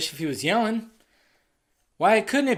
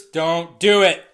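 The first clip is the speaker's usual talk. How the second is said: slow, louder "Don't do it"; firm slow plea; 3 semitones lower, 6 dB louder, much slower than usual. Retold or imitated. Alfred warning Bruce, slow firm plea. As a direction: plead with him, each word slow. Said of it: "he's trying to tell Bruce".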